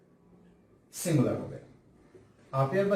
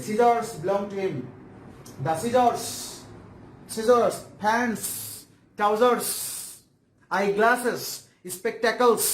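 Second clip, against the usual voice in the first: slow list; 7 semitones higher, much slower than usual. Shown as a demonstration. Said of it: "listing plural nouns".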